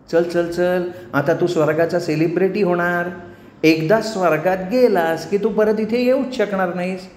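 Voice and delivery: high, insistent temptress; triumphant apsara. Acted. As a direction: drag him off with gloating sweetness. Menaka drags Ganya. (विजयी अप्सरेचा चढा आवाज)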